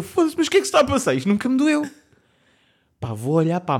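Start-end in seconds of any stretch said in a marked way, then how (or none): 0.0-1.9 high, loud retold cry of pain and disbelief; shocked outburst. Older brother recalls his own shock at the razor, high and loud. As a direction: blurt it as the razor suddenly hurts you.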